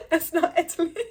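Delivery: high laugh into the words; real amused laughter. Real, not acted. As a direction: laugh at the joke as you say it. Joke about Rome, Kentucky.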